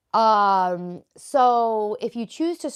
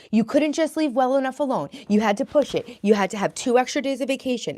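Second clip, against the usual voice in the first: a hint of a scolding parent, louder and higher; inner critic; 6 semitones higher, 6 dB louder than usual. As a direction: nag yourself like a disappointed parent. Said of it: "That's, like, my parents' voice".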